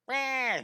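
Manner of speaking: short, high meow; quick high cry of a spooked cat; imitated cat yowl. Drawing teacher voicing splayed claws, high cat yowl. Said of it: "his claws are like".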